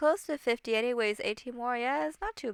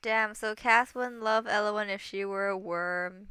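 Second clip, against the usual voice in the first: usual speaking voice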